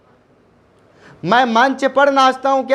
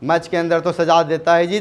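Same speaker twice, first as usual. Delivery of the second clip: ordinary talk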